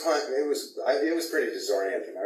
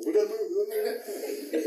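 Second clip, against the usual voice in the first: mock panic; 9 semitones higher than usual. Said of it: "everybody gets panicky".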